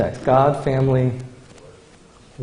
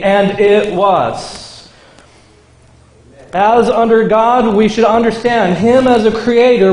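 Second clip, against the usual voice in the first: preaching; 5 semitones higher than usual. Acted. Pastor to the congregation, preaching at full force. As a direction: proclaim each line up high to the congregation.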